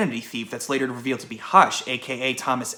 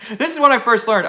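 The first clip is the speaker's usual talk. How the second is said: laughing through the words; high laughing burst; 11 semitones higher, 6 dB louder than usual. Real, not acted.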